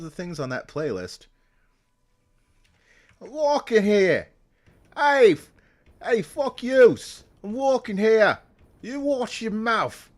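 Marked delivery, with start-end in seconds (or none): 3.2-4.3 put-on shouted New York accent, much higher and louder; hammed-up New York street yell. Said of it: "they know about the New York accent". 5.0-10.1 put-on yelled New York accent, much higher and louder; hammed-up New York rant; shouted New York accent. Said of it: "they know about the New York accent".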